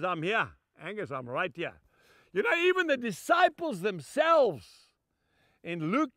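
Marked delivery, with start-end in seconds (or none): 0.0-1.7 hushed, lower comforting reply; lightly voiced quiet reply from God; gentle reassurance. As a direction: answer softly like a father calming a child. God answers the pleading speaker.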